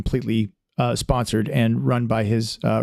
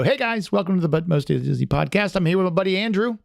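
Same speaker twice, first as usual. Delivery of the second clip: higher, quick podcast sign-on; internet-host welcome, raised pitch; 6 semitones higher than usual. Shown as a demonstration. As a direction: launch into your usual show intro, pitched up. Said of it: "I always go".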